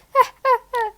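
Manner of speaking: short high teasing laugh; a high laugh at Ralphie; mocking laugh. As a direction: laugh at him. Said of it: "Keisha laughed".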